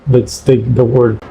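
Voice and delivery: usual speaking voice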